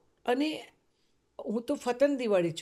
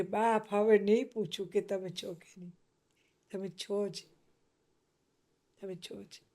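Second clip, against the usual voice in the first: fading devout prayer. Real, not acted. Prostrate, prayer to Shiva.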